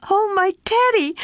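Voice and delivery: high, joyful cry of a name; crying joyfully. A narrator's reading. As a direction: call out his name in pure delight. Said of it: "crying joyfully".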